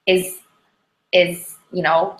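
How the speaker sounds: ordinary delivery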